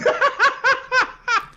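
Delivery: high-pitched cackling laugh; real high laughter. Real, not acted. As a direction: burst into high, helpless laughter. Cracks up at his age.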